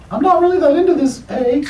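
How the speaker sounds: high-pitched little voice for a talking hand; squeaky, reluctant Canadian hand puppet; Canadian emo puppet voice. Acted. Comic's hands refusing him, in a high reluctant puppet voice. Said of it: "my hands [name] and [name] ... they're like".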